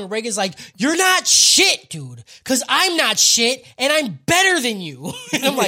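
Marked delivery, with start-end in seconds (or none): 0.8-5.1 loud, high, slow emphatic takedown; mock-heroic shouted retort; acted put-down. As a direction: punch each word at a boastful rival. Acted put-down of boastful villains.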